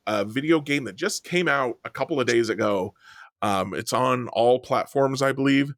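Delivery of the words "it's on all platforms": usual speaking voice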